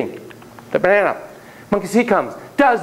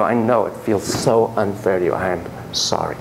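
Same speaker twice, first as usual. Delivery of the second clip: gentle sympathy; 8 semitones lower than usual. Shown as a demonstration. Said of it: "and you go".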